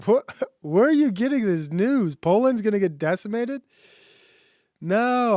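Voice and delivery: can't believe what chat just said; shocked disbelief. Real, not acted. Viewer says Poland will be decimated.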